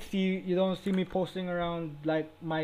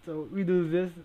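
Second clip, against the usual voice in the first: ordinary talk, nothing marked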